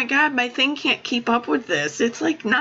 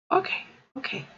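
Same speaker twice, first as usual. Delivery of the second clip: usual speaking voice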